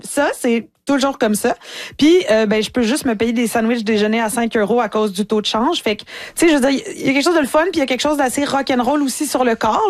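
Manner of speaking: usual speaking voice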